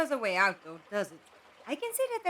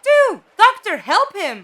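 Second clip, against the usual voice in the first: much louder, higher urgent yell; alarmed shout; 6 semitones higher, 16 dB louder, slower than usual. Acted. Companion yelling at the Doctor to help Two urgently.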